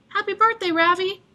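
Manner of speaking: high bright birthday wish; hopeful greeting. A narrator's reading. Boy imagining worker's birthday greeting, hopeful lilt. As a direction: sing it out hopefully.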